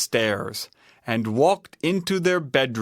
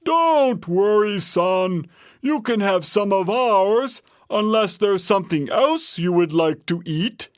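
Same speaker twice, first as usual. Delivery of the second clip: lightly voiced father bear, raised pitch; reassuring Papa Bear; 7 semitones higher than usual. A narrator's reading. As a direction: comfort the cub as papa bear. Papa Bear reassures his son.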